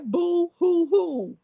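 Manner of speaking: high, slow, hammed-up fake sobbing; sing-song pretend crying; mock boo hoo. Reading a viewer's teasing comment, mock crying. Reads chat mocking crying.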